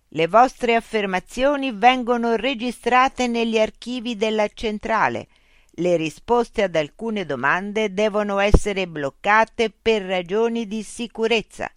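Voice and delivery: high, loud, slow loudspeaker machine. Acted. Loudspeaker robot voice addressing Mitch. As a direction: speak as a polite, mechanical information unit.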